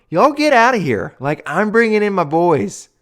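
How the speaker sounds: imitating Deion, high and scornful; mock dismissal. Host mocking Deion telling players to leave, high and scornful. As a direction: toss them out like a mock coach. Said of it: "right after he told them".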